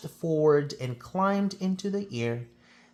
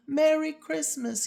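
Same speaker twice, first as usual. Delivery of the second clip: much higher, called-out Merry Christmas; shouted greeting; 13 semitones higher than usual. Acted. Conductor calling from the moving train, high shout. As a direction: throw it across the distance, hands cupped round the mouth. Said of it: "he shouted".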